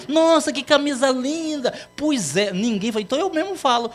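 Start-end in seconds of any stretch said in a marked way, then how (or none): 0.1-1.7 gushing compliment. Pretend. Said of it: "pra ver se alguém fala".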